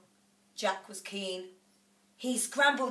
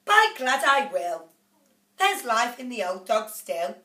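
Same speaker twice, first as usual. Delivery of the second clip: defiant cry; 3 semitones higher, 7 dB louder than usual. A narrator's reading. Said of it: "The mother cried".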